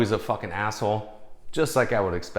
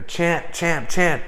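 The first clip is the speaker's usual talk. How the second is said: higher mock crowd calling out; calling out champ; 6 semitones higher, 3 dB louder, much slower than usual. Fans on the red carpet calling to the boxer, mild calling out. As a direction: shout his name across the carpet, lightly.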